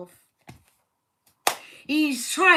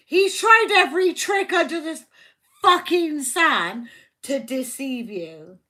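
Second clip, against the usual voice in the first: angry outburst; 4 semitones higher, 7 dB louder than usual. Real, not acted. Tarot reader angered at deceiver.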